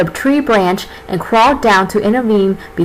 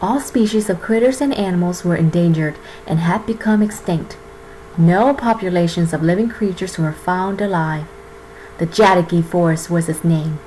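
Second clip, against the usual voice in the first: epic fantasy narrator; 4 semitones lower, 4 dB quieter than usual. Acted. Narrates fairy-tale opening.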